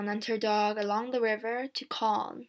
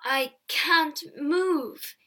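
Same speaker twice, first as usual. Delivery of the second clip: halting, drawn-out words at higher pitch; very slow; 5 semitones higher, 3 dB louder, much slower than usual. A narrator's reading. Stricken peddler lying helpless, talking very slowly. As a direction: speak with great effort, very slowly. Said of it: "began to talk very slowly".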